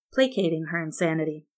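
ordinary delivery

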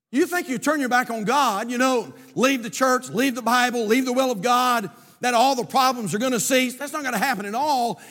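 high, forceful pulpit delivery; preaching. Real, not acted. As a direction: drive the argument home loudly, phrase after phrase.

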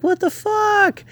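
a high, slow, stretched-out shocked cry; shocked mom. Retold or imitated. Mom finds the wrecked house, high shocked cry. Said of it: "She's like".

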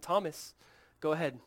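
gentle invitation with unhurried pauses; loving, patient. Acted. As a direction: coax a doubter tenderly, voice settling down. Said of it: "It's so loving. It's so grace-filled. It's so patient".

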